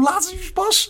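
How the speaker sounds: mocking the lads. Pub mates defending Murphy every week, high mocking impression. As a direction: mimic the lads in a high put-on voice. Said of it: "Everyone going".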